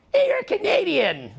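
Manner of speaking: high, drawn-out teasing cry; exaggerated high-pitched mock exclamation; mocking callout. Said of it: "everyone goes".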